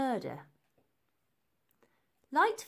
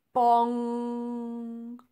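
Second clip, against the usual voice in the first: long, loud ringing bong like a huge bell; be Big Ben striking; 3 semitones higher, 8 dB louder than usual. A narrator's reading. Big Ben chimes above Elsie, a loud held bong. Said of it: "bonged Big Ben".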